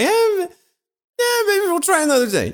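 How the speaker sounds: high-pitched reluctant mock voice; acted chickening out in a high voice; reluctant backing out. Voicing the couple backing out.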